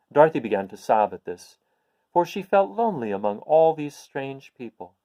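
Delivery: ordinary delivery, nothing marked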